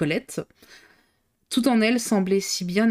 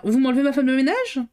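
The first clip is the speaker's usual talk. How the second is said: good-humoured; 6 semitones higher, 3 dB louder than usual. A narrator's reading. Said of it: "avec bonne humeur".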